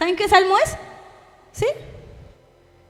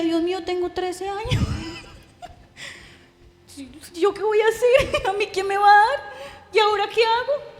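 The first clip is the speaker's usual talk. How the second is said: higher, anxious pleading with nervous laughs; desperate girl; 4 semitones higher than usual. Retold or imitated. Abandoned teenage girl crying out to God, anxious despair. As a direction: panic like a lost thirteen-year-old. Thirteen-year-old in despair.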